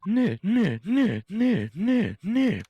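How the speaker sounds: loud high singsong taunt; taunting nah nah. Acted. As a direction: tease loudly like a child. Taunting chant.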